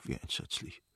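breathless whispered horror; husband alone, whispering in horror; despairing whisper. Acted. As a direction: barely voice it, aghast.

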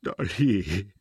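tearful call to his wife; sobbing. A narrator's reading. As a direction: sob out the name. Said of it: "sagte er bereits schluchzend".

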